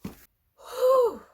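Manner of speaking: high, loud exhaled whew; whew of relief. Real, not acted. Vlogger composing herself after tearing up, a relieved whew. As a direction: blow out the emotion with a high whew.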